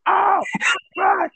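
high, loud mock-horrified cry; commentator's hysterical shout. Commentator reacting to a chair shot, hysterical shout. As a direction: overblown shouting with a wailing finish.

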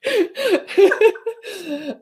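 laughing through tears, high pitched; fighting back tears with a laugh; tearful laughter. Real, not acted. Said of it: "I'm trying not to cry".

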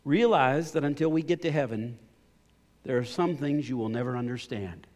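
loud declaring take; emphatic first reading. Shown as a demonstration. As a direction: proclaim it, leaning hard on realize. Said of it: "this is how he did it".

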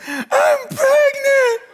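high, slow, far-carrying yell; wife yelling. Retold or imitated. Wife wakes him at three a.m., shrieking the news. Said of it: "And she yells".

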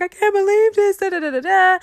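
high, loud tearful wail of disbelief; retold crying. Crying to friend Darian on the phone, high tearful wail. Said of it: "I'm just crying".

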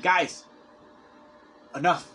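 mildly fed up, with a pause before the last word; mock frustration. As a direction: act out running out of patience. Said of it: "we would get frustrated".

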